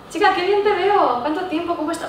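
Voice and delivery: chirpy over-the-top friendly greeting; gushing greeting. Shown as a demonstration. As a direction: greet an old friend with delight. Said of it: "hacemos un comentario del aspecto".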